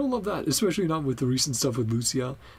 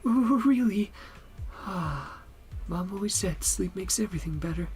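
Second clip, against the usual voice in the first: higher-pitched, eager game character; relieved sleepless client; 11 semitones higher than usual. Acted. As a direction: play a grateful client cheered by advice.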